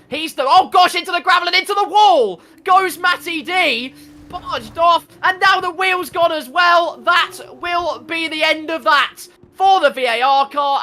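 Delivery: loud, high crash call; excited shouting. Real, not acted. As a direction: yell it out as the car hits the wall. Commentator calls a crash live.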